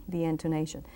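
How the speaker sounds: ordinary talk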